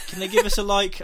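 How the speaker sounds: half-laughing, unravelling; giddy. Real, not acted. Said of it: "I've lost it".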